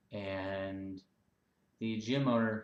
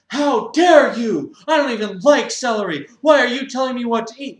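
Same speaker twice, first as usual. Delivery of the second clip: clutch your pearls; mock outrage; 11 semitones higher, 16 dB louder than usual. Member offended at celery suggestion, high loud huff.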